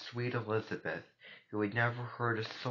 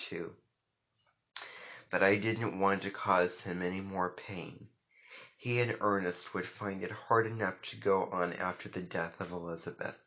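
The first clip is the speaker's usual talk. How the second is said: usual speaking voice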